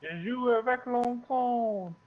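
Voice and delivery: high, slow, playful French phrase; drawn-out French. Real, not acted. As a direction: stretch the French out loudly and high. Playful French phrase, later repeated on request.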